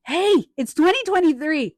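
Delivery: loud, high-pitched objection; retold mock scolding. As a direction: call out dad in protest.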